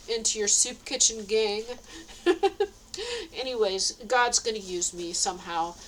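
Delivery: ordinary talk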